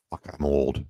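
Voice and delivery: low, deflated groan at his reflection; a low, resigned "I'm old"; dismayed mutter. Retold or imitated. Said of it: "I look at my face and I go".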